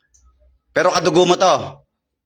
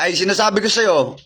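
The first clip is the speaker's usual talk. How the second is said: ordinary delivery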